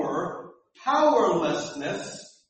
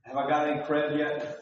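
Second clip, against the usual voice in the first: usual speaking voice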